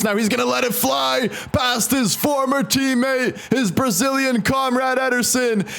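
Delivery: excited goal call. Real, not acted. Gamer celebrating his striker's goal, excited high shout. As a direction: roar the goal like a commentator.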